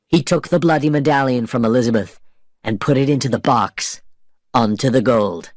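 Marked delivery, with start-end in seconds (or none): none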